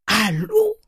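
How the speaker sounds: high, loud outraged cry; shocked shout. Acted. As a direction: yell it in disbelief. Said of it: "cria le bonhomme". (cri indigné du vieil homme)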